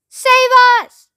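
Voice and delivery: high, loud cry for rescue; yelled plea. A narrator's reading. Grandma Georgina in the rocketing elevator, yelling for help. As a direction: yell for help at a high pitch. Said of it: "yelled Grandma Georgina".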